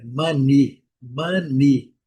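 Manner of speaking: money said mani with a short A-like vowel; money with a short open first vowel; English money. Shown as a demonstration. Teacher modelling English money for students. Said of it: "A pronúncia clássica".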